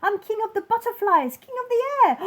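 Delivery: king exulting over his kingdom; ecstatic king. Acted.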